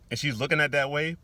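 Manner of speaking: normal talking voice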